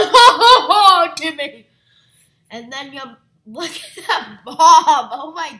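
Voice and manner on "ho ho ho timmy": high, loud ghostly ho ho ho; spooky ghost voice. Pretend. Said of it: "you can scare your friends".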